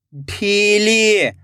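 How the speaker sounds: ढीली joined as one slow word; blended word. Shown as a demonstration. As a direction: read ढीली whole with drawn-out ई, falling at the end. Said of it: "खींच के पढ़ना है".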